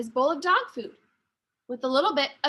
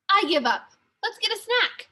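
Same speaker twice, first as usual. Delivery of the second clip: Sophia's voice; 6 semitones higher, 3 dB louder than usual. A narrator's reading. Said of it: "Sophia's stomach growled".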